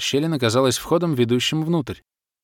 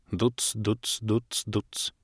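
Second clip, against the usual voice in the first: distant thumping beat; 8 dB quieter, much slower than usual. A narrator's reading. Distant music drifts to the narrator on the wind, faint thumping. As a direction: sound out faint, evenly spaced thumps. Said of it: "донеслось до нас с порывом ленивого ветерка".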